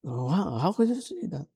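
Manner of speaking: high-pitched wondering question; mock amazement, pitched well up; amazed reaction. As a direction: marvel at it in a high voice. Acts out amazement at correct pronunciation.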